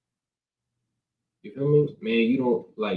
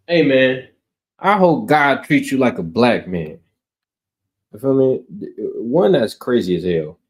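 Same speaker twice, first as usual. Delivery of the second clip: loud preaching; 7 dB louder than usual. Acted. Preaching at full force.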